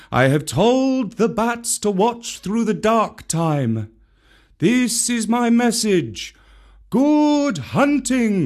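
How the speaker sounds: high, slow bird messenger; a kite calling news down from above; high kite calling out a message. Acted. Rann the kite delivers message.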